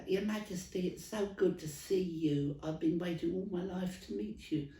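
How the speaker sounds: trying to sound English to the Queen, East End London; put-on London accent. Dublin stallholder greeting the Queen in East End London accent. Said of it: "You sound like you're from the East End of London".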